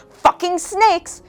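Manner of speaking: high, slow, drawn-out exclamation; dramatic reveal. Acted. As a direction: stretch it out for shock value.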